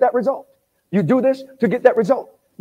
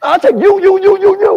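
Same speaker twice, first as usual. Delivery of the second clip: put-on higher, louder drunk picking a fight; mock drunk fighter; 8 semitones higher, 8 dB louder than usual. Preacher acting a drunk clubgoer, loud challenge. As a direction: play the tipsy brawler. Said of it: "you wanted to fight".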